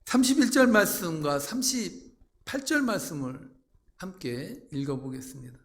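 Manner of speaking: ordinary delivery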